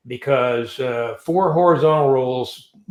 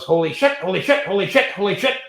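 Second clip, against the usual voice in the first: rising, higher mock panic, over and over; repeated panicked exclaim; 5 semitones higher, faster than usual. Retelling the pilot tumbling down, rising mock panic. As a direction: imitate a pilot spinning toward the ground. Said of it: "And then he goes".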